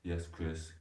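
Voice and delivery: ordinary talk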